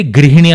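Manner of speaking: గృహిణి said "grihini"; i sound after gr in గృహిణి; Hindi pronunciation. Shown as a demonstration. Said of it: "గృహిణి అని పలకాలి". (గృహిణి హిందీలోలా "గ్రి"తో పలకండి)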